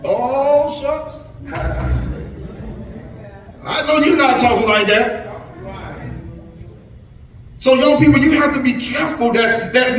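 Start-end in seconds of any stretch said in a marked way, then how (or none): 0.0-5.3 high, slow, scandalised voice of a grown-up recognising him; surprised church folk. Retold or imitated. Elders scolding Cornwell's son for his talk, high and shocked. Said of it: "they see you and they go".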